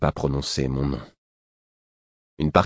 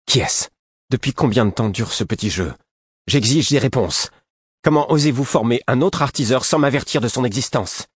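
higher, indignant demand for answers; furious; 7 semitones higher, 3 dB louder than usual. A narrator's reading. Furious prince confronting two men who hid things from him, furious. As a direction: fire off the questions in an outraged, raised voice. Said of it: "furieux contre nous deux".

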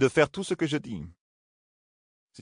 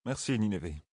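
low, soft, drained voice; lifeless voice; 5 semitones lower, 10 dB quieter than usual. Acted. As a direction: say it with no life left. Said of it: "d'une voix éteinte".

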